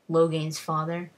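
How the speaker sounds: usual speaking voice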